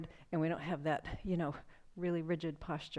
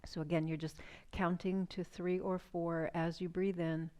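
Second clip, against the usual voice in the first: soothing breathwork guide; guided breathing meditation. Acted. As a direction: ease them into the breath, unhurried. Leads a guided breathing exercise.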